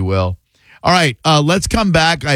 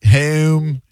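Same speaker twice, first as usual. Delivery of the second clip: fronted Philly O in "Home"; mimicking a Philly caller's "Home"; imitated Delco accent; 3 semitones higher than usual. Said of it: "It's almost like a surfer accent".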